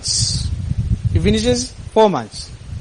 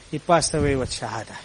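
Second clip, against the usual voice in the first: much softer and lower, reverent; hushed; 6 semitones lower, 8 dB quieter than usual. Real, not acted. Preacher softly revealing Yusuf died with Shahada. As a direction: let it fall almost to a breath. Sermon emotional climax.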